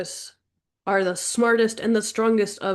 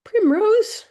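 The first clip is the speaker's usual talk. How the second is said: high squeak; 7 dB louder than usual. Real, not acted. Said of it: "joking aside".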